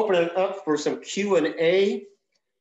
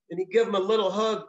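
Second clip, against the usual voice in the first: ordinary delivery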